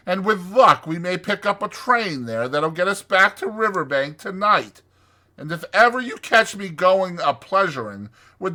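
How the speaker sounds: high, loud exasperation; fed-up, emphatic Rat; snorting Rat. A narrator's reading. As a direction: give up on him indignantly. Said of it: "he snorted".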